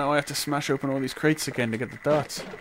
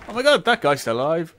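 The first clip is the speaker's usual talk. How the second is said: startled; 3 semitones higher, 7 dB louder, faster than usual. Real, not acted. Surprised during firefight.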